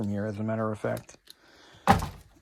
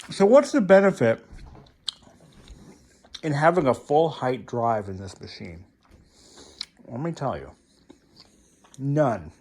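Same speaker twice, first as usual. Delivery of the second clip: chewing gum. Real, not acted. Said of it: "Excuse me, I'm chewing gum".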